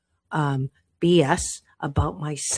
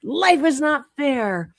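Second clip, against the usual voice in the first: much higher, bursting rock's rant; angry outburst; 6 semitones higher than usual. Acted. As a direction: blow up at life, voice cracking high. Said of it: "it just blows up and says".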